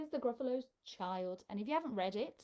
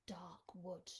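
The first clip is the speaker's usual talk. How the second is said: spooky whisper; 4 semitones lower, 11 dB quieter than usual. A narrator's reading. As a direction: breathe it out in a hush. Spooky wood in the story.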